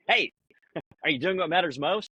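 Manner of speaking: perky high pop-up voice; bright prompting voice; reminder voice. Pretend. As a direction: chirp it like a cheerful app nudging you. Reminder app voiced as chirpy pop-up.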